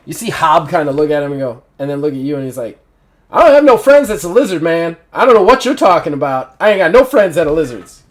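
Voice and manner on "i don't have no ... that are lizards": loud, higher, fast protest; hammed-up outraged grunt; indignant soldier. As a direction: bristle at the idea of a lizard friend. Soldier objects to lizard friend.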